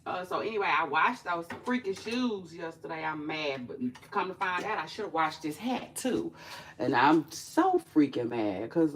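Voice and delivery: normal talking voice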